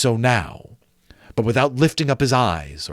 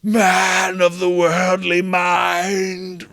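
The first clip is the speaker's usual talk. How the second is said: higher, slow, hollow moan; ghost voice; 8 semitones higher, 4 dB louder, much slower than usual. Acted. Marley's ghost addressing Scrooge, slow moaning ghost voice. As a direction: intone it like a restless spirit.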